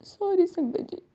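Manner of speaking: high, tearful voice, fighting back tears; emotional, choked up. Real, not acted. Thanking the sangat for her mother-in-law, choked up and tearful. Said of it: "मैं थोड़ा emotional हो गई".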